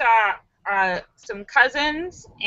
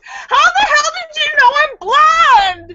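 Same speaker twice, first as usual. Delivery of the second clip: much higher, louder, laughing shout; frustrated shout; 6 dB louder, slower than usual. Acted. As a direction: blurt it out in exasperated disbelief. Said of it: "in frustration".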